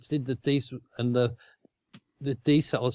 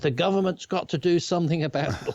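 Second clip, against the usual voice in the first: higher-pitched imitation of public grumbling; mock complaining; 6 semitones higher, faster than usual. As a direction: lightly imitate people demanding action. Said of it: "everybody was complaining and said".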